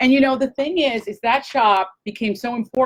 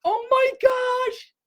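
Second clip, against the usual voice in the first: much higher, slow, near tears of joy; happy crying; 13 semitones higher, much slower than usual. Real, not acted. Overwhelmed by husband's sandal gift, crying for joy. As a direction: let the words crack into real happy tears. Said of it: "I'm crying".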